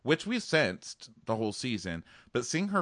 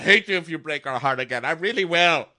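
louder, higher mock warning; mock threat; 6 semitones higher, 7 dB louder than usual. Sister warning Nick not to hurt her, mock threat. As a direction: threaten like a protective sister, hammed up.

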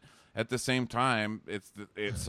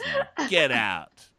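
mock dismissal; 5 semitones higher, 5 dB louder, much slower than usual. Acted dismissal of Bobblehead.

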